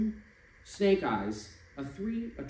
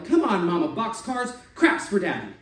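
loud, raised craps-table yell; street-corner dice yell, higher and louder; 5 semitones higher, 8 dB louder than usual. Acted. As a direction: bark it at the dice like a gambler. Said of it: "started yelling".

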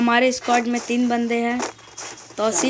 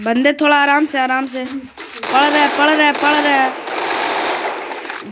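drawn-out urgent warning; urgent callout; 2 semitones higher, 4 dB louder, much slower than usual. Real, not acted. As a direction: stretch the words, tense and loud. Urgent calls under fire.

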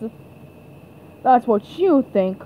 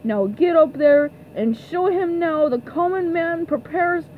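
ordinary delivery

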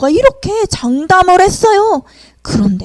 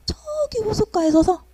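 usual speaking voice

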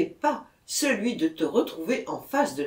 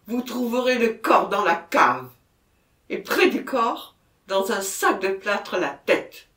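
loud, forceful disclosure; agitated confession; 4 semitones higher, 7 dB louder than usual. A narrator's reading. Murderer telling police where the body is, agitated confession. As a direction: push each phrase out forcefully.

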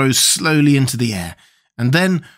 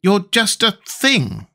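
lightly voiced boy, higher and slow; Tip's boy voice; 9 semitones higher, slower than usual. A narrator's reading. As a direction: answer in a higher boy's voice. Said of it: "answered Tip".

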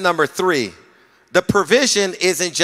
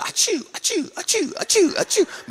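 high sneezing sounds; mock sneezes; 4 dB quieter than usual. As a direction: sneeze them out. Imitating sneezes.

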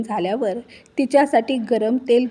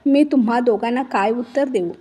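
usual speaking voice

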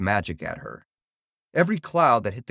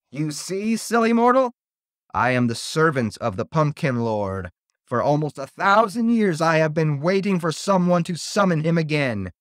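raised, forceful villain declaration; gloating evil monster; 5 semitones higher, 3 dB louder than usual. A narrator's reading. Servant of the Pumpkin Lord taunts Jenny, gloating and high. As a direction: taunt the mortal, pitched up and proud.

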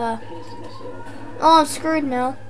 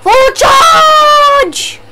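full-throated charging yell, much higher and louder; battle cry; 10 semitones higher, 7 dB louder than usual. Real, not acted. Player charging the horde, yelling a battle cry. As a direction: scream it as you rush in.

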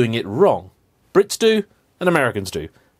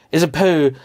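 ordinary talk

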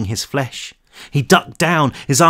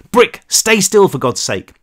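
urgent plea; 7 semitones higher, 4 dB louder than usual. A narrator's reading. Cal urging Brick.

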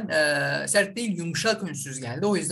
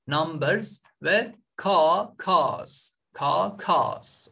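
numbers kelimesi ağır ağır, sonu z sesiyle; numbers'ı harf harf yavaşça söyle; çok yavaş örnek; much slower than usual. Shown as a demonstration. (öğrencilere yavaş okunuş)

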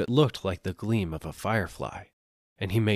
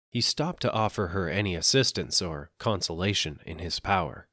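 plain reading voice

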